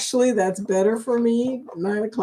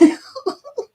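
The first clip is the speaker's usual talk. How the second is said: high giggle. Real, not acted. Laughing at chat joke.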